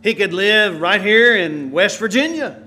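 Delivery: ordinary delivery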